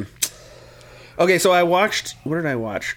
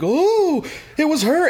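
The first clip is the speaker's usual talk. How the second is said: mock surprise; 13 semitones higher, slower than usual. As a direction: act out being shocked.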